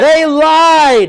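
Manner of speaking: high, loud accusation; modelled outrage. Shown as a demonstration. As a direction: belt out LIED. Coach models outrage, client repeats.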